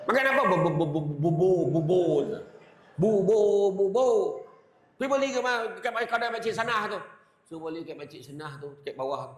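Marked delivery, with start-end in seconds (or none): 0.1-0.4 higher, louder mock snap; hammed-up angry wife snapping at her husband. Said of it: "dia marah dia". 0.5-2.2 scared husband stammering for porridge; timid stammer. Retold or imitated. 3.0-7.0 higher, louder mocking snap; mock his request, then order him off; hammed-up wife scolding her husband; mock angry wife.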